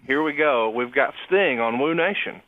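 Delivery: ordinary delivery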